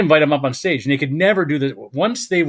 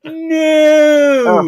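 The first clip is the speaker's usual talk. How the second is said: a drawn-out, loud, high no; long shouted no; 14 semitones higher, 7 dB louder than usual. Retold or imitated. Repeating the refusal to the club owner, drawn out and shouted. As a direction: hold a big yelled no. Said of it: "I go".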